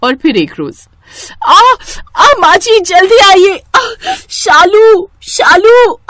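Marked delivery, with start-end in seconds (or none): 1.5-6.0 high, loud pained cries for help; crying out in pain. Acted. Pregnant wife calling for mother-in-law in pain, high, loud and desperate. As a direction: scream for help through the pain.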